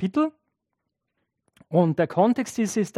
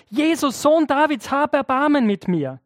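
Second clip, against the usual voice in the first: higher, urgent pleading call; crying out again; 7 semitones higher than usual. Retold or imitated. Blind beggar calling Jesus again, higher urgent cry. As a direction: call out over the crowd for mercy. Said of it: "Bartimäus schreit wieder".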